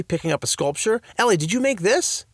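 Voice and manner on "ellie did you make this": high, eager question; excited question. A narrator's reading. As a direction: gush over it. Dad exclaims over sculpture.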